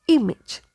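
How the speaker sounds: "image" as IM-ij, falling at the end; correct "image". Shown as a demonstration. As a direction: say "image" with short I twice. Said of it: "So it is".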